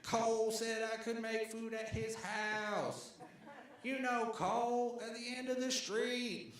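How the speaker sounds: high, drawn-out distant holler; Sheik yelling. Retold or imitated. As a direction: imitate a man announcing news to the whole street. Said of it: "Sheik is yelling at everyone".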